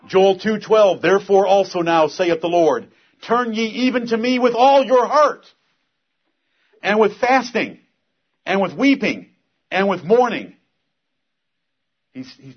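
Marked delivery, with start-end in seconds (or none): 0.1-10.6 loud, high, fervent scripture reading; preaching scripture. Acted. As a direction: proclaim the verse with fire. Reading scripture at full force.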